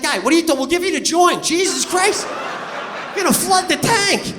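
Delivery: imitated fed-up attendant, high and loud; exasperated yelling. Gas attendant yelling at a customer overfilling, mock exasperated shout.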